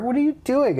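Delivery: high-pitched mock scolding question; exasperated teacher. Teachers catch a student doing no work, mock exasperated. Teachers confronting him in a retold story.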